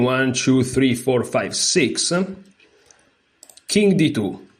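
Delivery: normal talking voice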